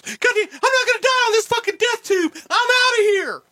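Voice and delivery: high, shouted, panicked cursing in a put-on voice; Jim Cornette impression shouting. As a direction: bail out of the plane yelling in his voice. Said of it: "And he's cussing the whole way and goes".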